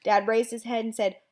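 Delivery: plain reading voice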